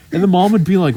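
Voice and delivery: ordinary talk, nothing marked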